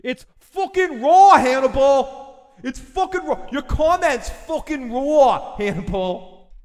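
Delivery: hammed-up furious chef, high drawn-out yell; loud, high-pitched kitchen tirade impression; Gordon Ramsay. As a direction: yell it like an outraged celebrity chef, stretching the words. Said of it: "I can't do a Gordon Ramsay accent".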